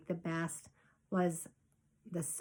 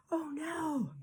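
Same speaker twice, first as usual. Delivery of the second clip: a soft, higher gasp of dismay; 7 semitones higher, 5 dB quieter than usual. A narrator's reading. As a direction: feel the dread land. Narrator voices the dreadful moment.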